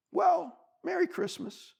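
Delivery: high, slow little goodbye; let-down bunny. A narrator's reading. Bunny turned away by beaver, disappointed. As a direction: draw it out, disappointed.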